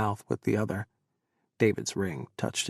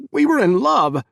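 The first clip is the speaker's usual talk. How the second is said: much higher and louder, screamed; 12 semitones higher, 12 dB louder than usual. Acted. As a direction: scream it at her. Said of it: "Chris screamed".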